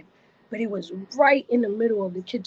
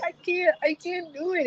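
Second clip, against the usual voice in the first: high, whiny mock sob; acted-out tearful helplessness, pitched high; crying refusal; 8 semitones higher than usual. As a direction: imitate pleading through tears. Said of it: "I'm literally crying".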